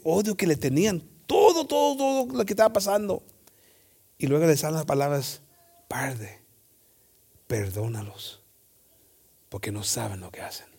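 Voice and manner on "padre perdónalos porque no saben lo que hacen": a soft, breathy plea for forgiveness; tender whispered prayer. Acted. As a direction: whisper it softly, falling away. Jesus's words from the cross.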